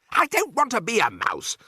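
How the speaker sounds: high-pitched tantrum cry; squealed refusal; protesting shout. A narrator's reading. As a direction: wail in protest like a stroppy child. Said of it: "Bruno shouted, jumping up and down".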